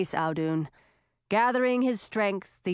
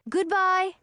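calling goodbye; 9 semitones higher than usual. Acted. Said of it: "Audun called".

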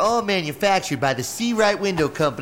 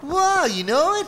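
surprised; 12 semitones higher, slower than usual. Acted. Surprised the adult knows comic.

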